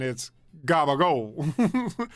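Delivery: slow Jersey Italian "gabagool", a dropped final vowel and voiced g and b; Jersey Italian accent. Shown as a demonstration. Streamer demonstrating how Tony's accent turns capicola into gabagool. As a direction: drawl "gabagool" slowly in his accent. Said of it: "but with his accent".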